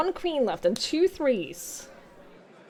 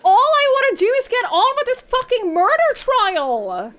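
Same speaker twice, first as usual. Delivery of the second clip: higher, louder exasperated shouting; frustrated rant; 10 semitones higher, 8 dB louder than usual. Real, not acted. Player rants at the game while losing. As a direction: vent it all at once.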